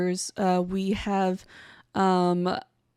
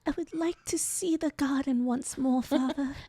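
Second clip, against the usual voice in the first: softer, mock-frail Victorian child voice; sickly Victorian child; 3 semitones higher, 7 dB quieter than usual. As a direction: play a feeble Victorian child softly pleading with Father. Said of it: "Victorian children that could be felled by a Dorito".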